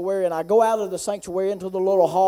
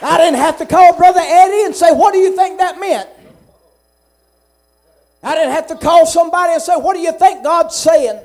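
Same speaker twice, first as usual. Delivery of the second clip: loud, high, fervent preacher's cry; preaching at full force; 7 semitones higher, 10 dB louder than usual. Real, not acted. Preacher testifying to the congregation, loud and fervent. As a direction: build the testimony into a ringing shout.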